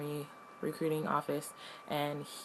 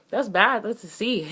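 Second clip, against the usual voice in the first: louder and higher, keyed-up; excited disbelief; 7 semitones higher, 11 dB louder than usual. Retold or imitated. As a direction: blurt it out, thrown by the score. Reacting to her test score.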